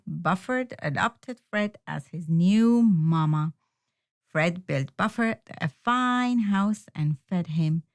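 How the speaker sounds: plain reading voice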